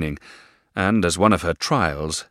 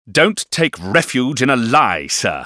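louder, higher fierce rebuke; fierce retort; 8 semitones higher, 8 dB louder than usual. A narrator's reading. Stepfather fiercely accuses the boy of lying. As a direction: bite it out fiercely. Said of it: "he returned so fiercely".

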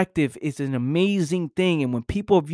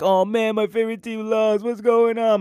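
mock upset fan; 6 semitones higher than usual. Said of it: "and I'm like".

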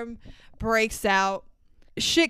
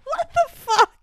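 disbelief. Retold or imitated. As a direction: shriek it like you can't believe it. Reacting in disbelief to the kids.

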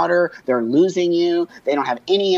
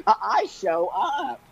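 laughing; 8 semitones higher, 3 dB quieter, much slower than usual. Real, not acted. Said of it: "laughs through words".

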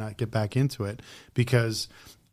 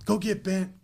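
rude customer; 7 semitones higher than usual. Retold or imitated.

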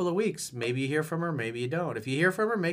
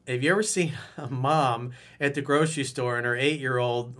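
usual speaking voice

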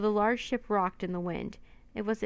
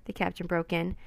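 ordinary delivery, nothing marked

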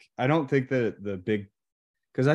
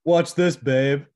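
cocky boast; 5 semitones higher, 7 dB louder, slower than usual. Retold or imitated. Voicing the show-off boast to wife.

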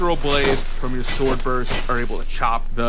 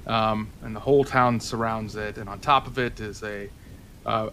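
scene setting; 7 dB quieter than usual. Acted. Game master describes new scene.

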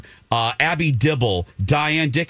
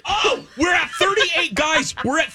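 excited shout. Real, not acted. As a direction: shout the good news to listeners. Donation total jumps.

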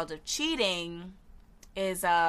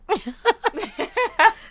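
high loud giggle; awkward laugh; 14 semitones higher, 10 dB louder than usual. Real, not acted. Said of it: "the awkward laugh".

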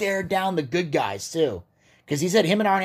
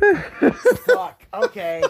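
high laughing curse; embarrassed laugh; 7 dB louder, much slower than usual. Real, not acted. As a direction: laugh it off, mortified. Caught getting the name wrong.

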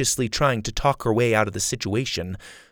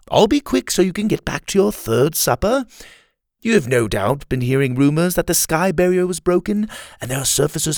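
higher, louder, cocky villain; villain mocking an official, higher; Kai, cocky; 8 semitones higher, 4 dB louder than usual. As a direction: sneer politely at the fat chancellor. Kai mocks the Chancellor.

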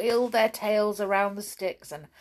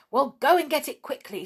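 quick, higher and urgent; urging; 6 semitones higher, 4 dB louder, faster than usual. A narrator's reading. Gobbo sends Twinkle to fetch it, urging. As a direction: hurry him along briskly. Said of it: "said Gobbo to Twinkle".

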